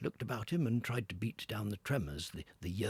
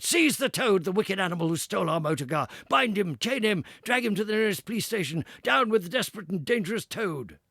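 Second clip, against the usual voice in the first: angry mob; 10 semitones higher, 10 dB louder than usual. Acted. Party attacking Toad.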